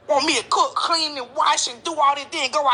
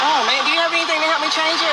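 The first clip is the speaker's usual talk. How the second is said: fast plea; 4 dB louder, faster than usual. Acted. Skit, woman's fast plea.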